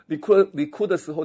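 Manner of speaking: ordinary talk, nothing marked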